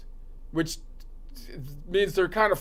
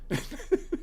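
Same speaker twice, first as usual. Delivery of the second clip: small high, soft laugh; chuckling; 5 semitones higher, 9 dB quieter than usual. Real, not acted. Comic after calling the old insult normal, soft chuckle. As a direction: chuckle briefly at your own joke.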